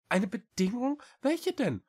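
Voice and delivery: high, rising small cat voice; questioning little cat. A narrator's reading. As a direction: ask back in a high little voice. (hohe Katerstimme, nachfragend)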